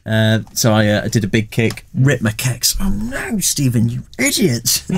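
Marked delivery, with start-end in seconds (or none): 2.8-4.8 self-scolding cry. Retold or imitated. Mocking himself after ripping pants.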